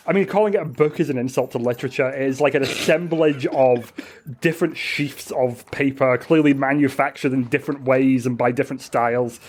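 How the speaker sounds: usual speaking voice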